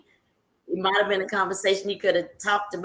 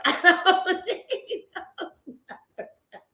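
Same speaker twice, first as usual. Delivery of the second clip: higher, slow, laughing through it; repeating her point to the man, laughing; laughing disbelief; 10 semitones higher, slower than usual. Retold or imitated. Repeats the line, laughing this time.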